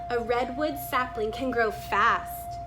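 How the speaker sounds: ordinary delivery, nothing marked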